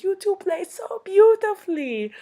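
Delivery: very high, loud imitation of an old European lady; gushing old lady. Old lady showering pianists with compliments, very high and loud. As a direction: gush in a piping old-lady voice. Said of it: "She was the cutest little old woman".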